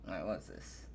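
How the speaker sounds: usual speaking voice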